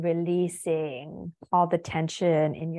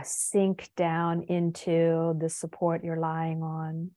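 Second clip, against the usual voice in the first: usual speaking voice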